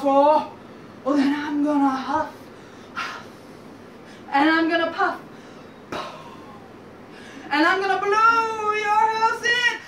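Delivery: loud, building wolf threat; threatening wolf. Acted. Wolf threatening the pigs behind the door, loud and menacing.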